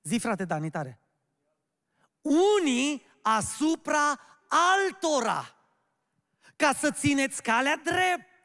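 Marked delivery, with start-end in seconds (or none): 2.6-8.3 slow, high, booming verse; loud recitation. Shown as a demonstration. Preacher prompting the church to say the verse, loudly. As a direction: proclaim the verse loudly, word by word. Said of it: "Zice tare, că știți versetul".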